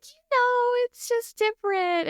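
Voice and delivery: high-pitched, sheepish mock explanation; awkward excuse. American explaining Texas to a Parisian, awkward.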